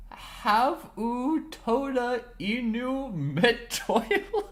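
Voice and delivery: reading made-up Kartbo words, laughing; sounding out have u toda inu metoilu; fake foreign language. Real, not acted. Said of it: "it's on my fucking language roster".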